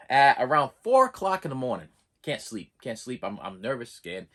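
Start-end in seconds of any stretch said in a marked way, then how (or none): 2.2-4.3 quiet and tense. Retold or imitated. Patient lying awake at night, hushed and worried. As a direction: drop right down, hushed and worried.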